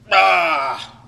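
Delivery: loud cathartic yell; venting yell. Shown as a demonstration. Demonstrating emotional vomiting of anger, loud yell. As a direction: let the anger burst out in one cry. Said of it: "emotionally vomit this".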